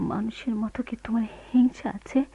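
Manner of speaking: breathy hushed accusation; whispered challenge. Acted. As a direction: whisper the doubt at him. Wife challenges husband in whisper. (ফিসফিসে অভিযোগ)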